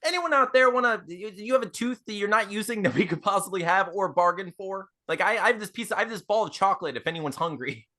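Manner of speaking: high-pitched call without screaming; restrained calling out. Acted. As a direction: call out, holding back from a scream. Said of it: "as loud as I can without screaming".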